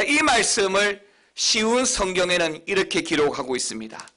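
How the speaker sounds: ordinary delivery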